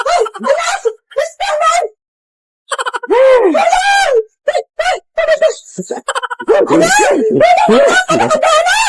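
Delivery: very high, laughing panicked wala; panicked denial. Acted. Mother hides money, high panicked squeal.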